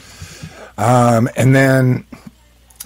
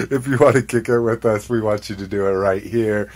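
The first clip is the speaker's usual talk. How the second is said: ordinary delivery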